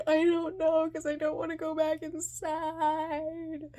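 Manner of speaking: put-on high, loud childlike wail; distressed whining. Kid to mother, dreading the return, distressed whining. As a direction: whine it out in mock despair.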